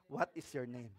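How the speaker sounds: low, very soft question; calm reply. Pretend. Worker answering a shouting boss, low and calm.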